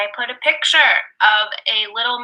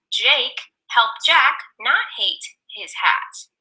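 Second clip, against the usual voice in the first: slow storybook; much slower than usual. Acted. Reading a story.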